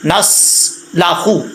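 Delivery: नस्लाखु very slow, the स held steady; correct slow reading. Shown as a demonstration. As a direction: draw out नस्लाखु slowly, the स not rebounding. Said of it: "आवाज़ लौटे नहीं". (नस्लाखु बहुत धीमे, स स्थिर)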